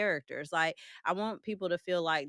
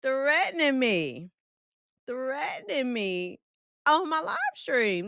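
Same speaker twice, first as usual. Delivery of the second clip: slow, raised-pitch playful indignation; mock outrage; 5 semitones higher, 5 dB louder, slower than usual. Streamer to a teasing viewer, mock outrage. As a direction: draw it out as if scandalized.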